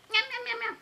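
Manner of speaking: high, playful meows; meowing cat. Pretend. Playing with the toys, meowing for the kitty. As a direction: meow like a little kitten.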